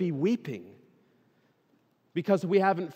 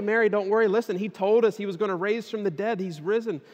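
higher, louder imagined reassurance; excited reassurance; 7 semitones higher, 6 dB louder than usual. Acted. What the disciples don't say to weeping Mary, acted excitement. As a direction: act out eager good news. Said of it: "they're so excited".

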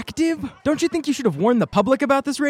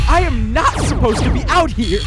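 ordinary talk

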